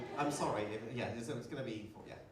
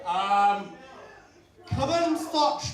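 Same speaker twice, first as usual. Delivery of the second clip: loud, high street-promoter voice; hammed-up theatre promoter; flyer man; 4 semitones higher, 12 dB louder, much slower than usual. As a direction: call out an awkward pitch to passers-by. Flyer man hawking his play.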